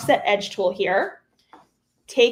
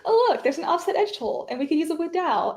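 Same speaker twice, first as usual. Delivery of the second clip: mock excited discovery; 7 semitones higher than usual. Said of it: "And then I was like".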